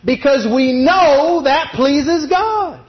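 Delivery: slow, high refrain, GOD landed with a fall; emphatic refrain. Acted. Preacher repeats his refrain to the church, slow and emphatic. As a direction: hammer home the refrain slowly.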